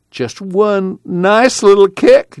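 high, slow wheedling plea; pleading. A narrator's reading. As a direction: beg for just one kick, drawn out high.

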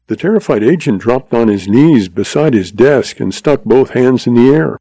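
usual speaking voice

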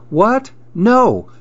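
high, rising "What?", then a high "No"; storybook friend taken aback; surprised denial. A narrator's reading. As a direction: react in startled disbelief. Friend denies a surprising accusation.

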